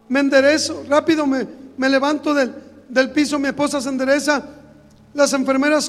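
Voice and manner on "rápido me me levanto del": ordinary delivery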